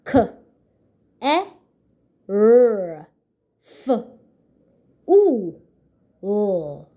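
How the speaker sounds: careful sounded out as K, E, R, F, U, L with pauses; sounding out. Shown as a demonstration. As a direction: say each sound of careful separately. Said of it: "What are the sounds in the word careful?".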